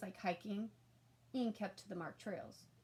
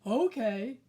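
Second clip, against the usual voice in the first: much louder, odd rumbling creature voice; stove monster; 11 dB louder than usual. A narrator's reading. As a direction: object like a reasonable monster.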